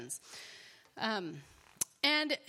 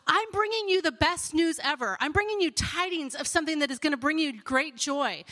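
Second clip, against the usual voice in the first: higher, louder herald of good news; excited proclamation; 4 semitones higher, 5 dB louder than usual. Acted. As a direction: announce it like the best news ever. Acting out heralds of great news.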